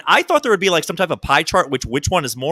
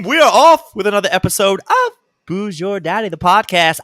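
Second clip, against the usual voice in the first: excited; 5 semitones higher, 5 dB louder than usual. Acted. Repeats show slogan.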